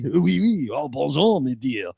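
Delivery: softly hammed-up French; a lightly played cartoon Frenchman; mock French. Playing the fake Frenchman to the cohost, light mock French. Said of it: "living life as that asshole".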